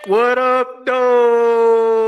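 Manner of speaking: high, loud hype call; hype shout. Real, not acted. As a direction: yell it out like a hype man. Hype greeting shouted back.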